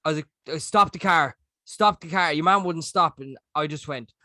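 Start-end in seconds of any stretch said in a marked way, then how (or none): none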